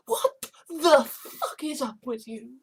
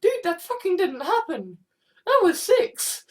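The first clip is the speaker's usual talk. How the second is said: exasperated protest; 6 semitones higher, 5 dB louder than usual. Pretend. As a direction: voice cracking high and loud in disbelief. Arguing about Doctor Who.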